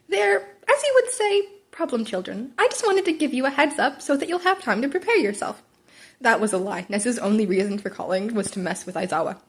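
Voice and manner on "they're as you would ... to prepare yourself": high teasing voice; playful Nezu. A narrator's reading. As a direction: needle him sweetly.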